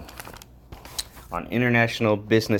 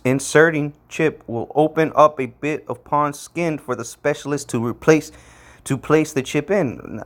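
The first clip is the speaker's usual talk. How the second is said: "inserting" and "open up" raised and slowed; emphasis on inserting; 5 semitones higher than usual. Shown as a demonstration. Preacher reading the article aloud, stressing the insertion. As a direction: press on "inserting chip" and "open up". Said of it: "I emphasize that insert and opening up the skin".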